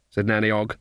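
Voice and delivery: plain reading voice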